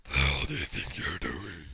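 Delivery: very low, loud challenge; deep-voiced hero confrontation; menacing character voice. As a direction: drop deep and confront them. Acted confrontation line, voiced deeper.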